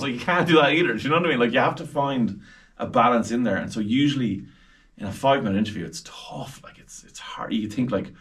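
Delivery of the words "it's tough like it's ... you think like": ordinary delivery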